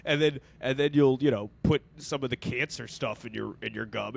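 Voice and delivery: usual speaking voice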